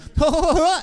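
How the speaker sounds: high, mock "ho" laugh; high put-on laugh; imitated creepy laugh. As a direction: imitate the kid's unsettling laugh. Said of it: "he did the laugh".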